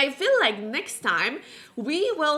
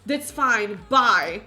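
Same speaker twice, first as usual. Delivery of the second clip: louder clipped bye; curt loud goodbye; 6 dB louder than usual. Acted. Helena brushing off Rowan on her way to sleep, loud and curt. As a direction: brush him off, done talking.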